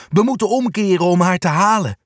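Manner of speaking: usual speaking voice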